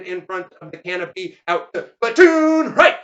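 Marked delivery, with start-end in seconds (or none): 2.0-3.0 loud, high parade call; shouted drill command. Acted. Veteran to his platoon, shouted drill order.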